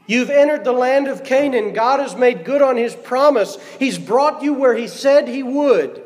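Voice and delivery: high-pitched, urgent Joshua address; impassioned preaching. Acted. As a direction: preach it insistently to the people.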